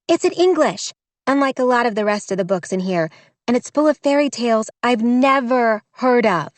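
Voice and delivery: high, eager rush of words; bubbling over with discovery; excited girl. A narrator's reading. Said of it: "bright with excitement".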